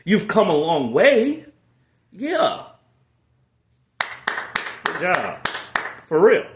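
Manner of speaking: high, loud cheering; encouraging praise. Real, not acted. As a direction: cheer them on. Encouraging the viewer.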